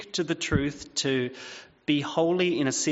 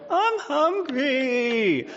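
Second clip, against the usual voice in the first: high, very slow, drawn-out whine; whiny hungry child; 11 semitones higher, much slower than usual. Retold or imitated. A parent imitating his kids whining for food. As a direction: stretch it into a long childish whine. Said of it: "they sound like that".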